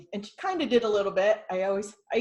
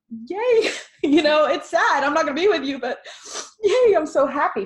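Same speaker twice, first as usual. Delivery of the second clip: much higher and louder, beaming; joyful cheer; 11 semitones higher, 11 dB louder than usual. Retold or imitated. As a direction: cheer them on with delight. Cheering death as going home.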